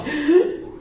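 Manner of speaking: sharp, high intake of breath; demonstrated gasp. Shown as a demonstration. Preacher shows the dying child's breathing, demonstrated gasp. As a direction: imitate a child gasping for breath. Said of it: "Like this".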